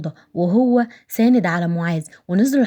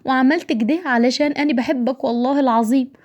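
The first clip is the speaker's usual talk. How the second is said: high-pitched tearful pleading, acted; 6 semitones higher than usual. A narrator's reading. Wife confessing love to her departing husband, tearful higher voice. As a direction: plead through mock tears, pitched up. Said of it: "قطعته نارين بعياط".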